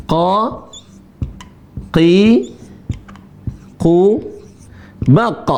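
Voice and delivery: "qa, qi, ku, baq" each syllable separate and heavy; syllable drill. Shown as a demonstration. Teacher showing how qaf is read, syllable by syllable. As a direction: drill the letter qaf through its vowels. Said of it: "Huruf qa cara bacanya adalah".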